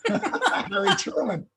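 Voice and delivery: high, slow laugh running into his name; real laughter as he names Truman. Real, not acted. Speaker laughing over the man who met Truman unknowingly, high and slow.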